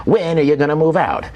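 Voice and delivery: lilting countdown host quizzing his son; Casey Kasem. Retold or imitated. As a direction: tease each question out like radio trivia. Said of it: "and he goes".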